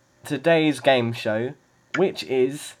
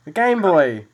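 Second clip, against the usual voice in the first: excited; 2 semitones higher, 6 dB louder than usual. Real, not acted. Host confirms correct answer.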